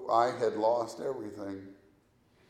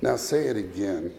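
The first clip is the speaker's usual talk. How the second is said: normal talking voice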